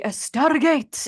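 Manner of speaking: put-on thick Spanish accent. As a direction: stretch it out dramatically in a heavy Spanish accent. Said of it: "in a very thick Spanish accent".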